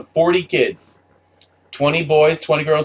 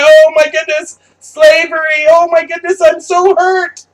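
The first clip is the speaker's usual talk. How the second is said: high, loud, shrill-sounding mock sobbing voice; mock crying; 10 dB louder than usual. Mocking the crying man in the background, hammed-up wail. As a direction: ham up a hysterical wail. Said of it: "starts to cry".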